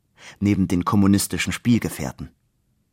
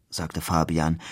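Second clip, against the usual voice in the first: ordinary delivery, nothing marked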